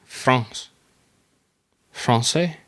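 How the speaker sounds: France said the French way, playful; mock French accent.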